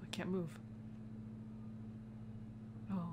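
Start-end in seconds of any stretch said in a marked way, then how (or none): none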